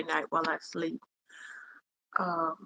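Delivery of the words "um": ordinary talk, nothing marked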